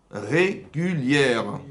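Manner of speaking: « régulières » étiré très lentement, syllabe par syllabe; drawn-out word. Shown as a demonstration. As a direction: fais sentir le jeu de mots en étirant « régulières ». Wordplay régaler, rigoler, régulier. (« régulières » prononcé très lentement)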